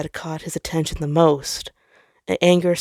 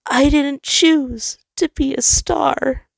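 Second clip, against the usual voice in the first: very high, louder and slow, full of grief; grieving; 9 semitones higher, 4 dB louder, slower than usual. Acted. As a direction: ache over every word. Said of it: "he grieved".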